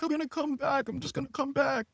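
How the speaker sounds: high-pitched girlfriend imitation; mock insistent girlfriend. Mimics drunk girlfriend insisting.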